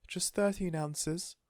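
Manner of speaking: high, very slow, sorrowful; doting lady. A narrator's reading. Missus Silver telling the weight, sorrowful. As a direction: mourn the tiny number.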